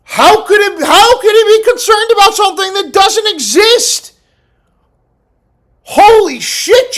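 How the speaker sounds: high, loud disbelief; incredulous outburst. Real, not acted. Streamer shouts in disbelief at the question.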